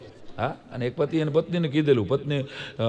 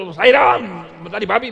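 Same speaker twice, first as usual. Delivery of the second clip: alarmed friend; 5 semitones higher, 3 dB louder than usual. Retold or imitated. Atul rushing in alarmed.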